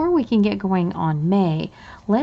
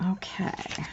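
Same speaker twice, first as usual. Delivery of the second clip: whisper; 11 dB quieter than usual. Real, not acted. Counting savings to herself.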